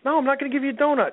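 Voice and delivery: put-on high, fast, defiant kid voice; defiant child. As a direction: refuse like a stubborn little girl. Daughter refusing mother.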